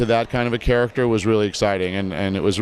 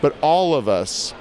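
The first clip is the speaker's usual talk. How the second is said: ordinary talk, nothing marked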